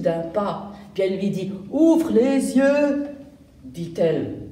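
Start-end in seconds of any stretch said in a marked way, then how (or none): 1.7-3.1 slow, loud grumbling order; cross girl growls at the one who stepped on her; growling. Acted. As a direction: drag it out like an annoyed growl. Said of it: "en grognant".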